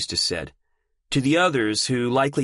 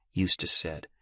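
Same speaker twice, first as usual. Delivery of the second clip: ordinary delivery, nothing marked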